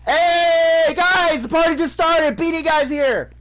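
high, loud party announcement shout; calling out. Real, not acted. Gamer announces Beanie Guy's arrival, excited shout. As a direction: holler to the whole group, drawing out the hey.

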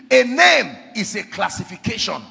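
loud, emphatic pulpit teaching; full-force preaching. Real, not acted. Preacher explaining God's name to the congregation, full-force preaching. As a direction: drive each point home loudly.

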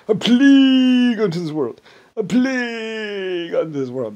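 loud, high wail with PLAGUE stretched out; hammed-up psychotic cry of doom; psychotic doomsayer. Imitating a man gonna plague the world, psychotic doomsayer. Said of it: "that was psychotic".

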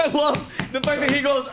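usual speaking voice

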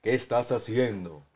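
low, slow, soft voice of God; voice from heaven. Acted. God catches the lady hiding trash, voice from heaven. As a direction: play God speaking down, deep and unhurried. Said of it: "una voz del cielo que le dijo".

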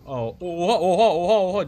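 loud, high, laughing shock; real loud laughing "oha" at sudden damage; shocked yelling. Real, not acted. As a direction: yell out in disbelief. Sudden big hit in game.

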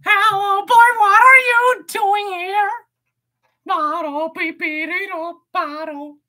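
high, loud cartoon voice, slow; squeaky cartoon greeting; silly character voice. Streamer goofing in a high cartoon voice.

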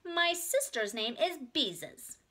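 put-on high, slow child announcement; generous little girl. A narrator's reading. Ramona volunteers her sister's name, slow and generous. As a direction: offer it grandly like a small child. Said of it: "then added generously".

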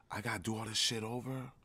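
ordinary talk

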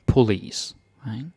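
ordinary talk

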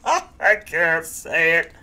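slow words through giggles; giggling. Acted. Rhinoceros giggles, too shy to tell its name. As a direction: giggle as you try to speak. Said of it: "giggled the rhinoceros".

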